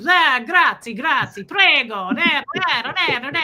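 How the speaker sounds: hammed-up Italian showman babble; Luigi. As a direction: imitate an exuberant Italian restaurant cook. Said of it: "And he'll be like".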